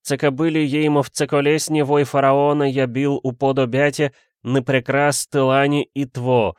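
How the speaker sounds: high, trance-like poetry declamation; crying out verse. A narrator's reading. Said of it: "выкрикивал свои стихи, закатив глаза".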